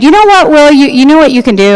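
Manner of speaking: sharp, high, flippant retort; flip snap at son. Retold or imitated. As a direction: snap it back at him, cutting. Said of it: "And I was kind of flip".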